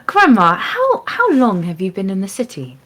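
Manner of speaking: ordinary talk, nothing marked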